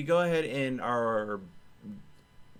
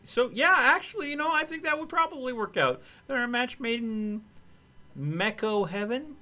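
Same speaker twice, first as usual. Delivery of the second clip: high-pitched joking; 11 semitones higher than usual. Real, not acted. Joking about robot romance.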